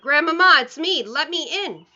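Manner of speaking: shouting. A narrator's reading. Boy calling grandmother through the door, loud shout fading. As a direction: yell through the door to be let in. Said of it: "I shouted as loudly as I possibly could".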